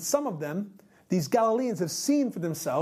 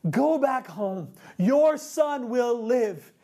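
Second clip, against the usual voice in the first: slow, drawn-out proclamation; ringing, unhurried pronouncement; speaking life; 3 semitones higher, much slower than usual. Acted. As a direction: declare it with authority, word by word. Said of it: "He speaks life".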